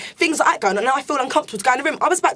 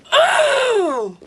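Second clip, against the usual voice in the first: exasperated groan; 8 semitones higher than usual. Real, not acted. Exasperated before diary room.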